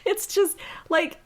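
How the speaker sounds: high, halting words after laughing; breaking up laughing. Real, not acted. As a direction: struggle to recover from a laughing fit. Still overcome by laughter at passage.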